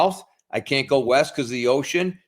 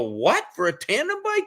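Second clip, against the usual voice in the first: incredulous; 10 semitones higher than usual. Retold or imitated. Customer hearing nine thousand for a bike, incredulous. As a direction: re-enact sticker shock. Retells shock at higher price.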